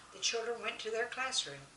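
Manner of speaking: usual speaking voice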